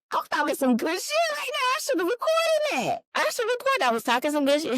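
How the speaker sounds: high, excited bragging; proud squeal. Retold or imitated. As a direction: brag about her own good speech, squealing. Proud of her own speech.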